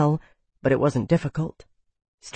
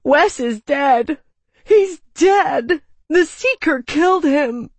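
high, loud tearful outcry; sobbing; 10 semitones higher, 5 dB louder, slower than usual. A narrator's reading. Jamie telling Wanda and Jared of a death, sobbing. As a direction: wail the terrible news, distraught. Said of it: "he sobbed".

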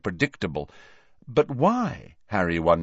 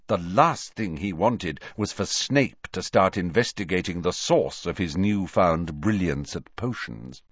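ordinary delivery

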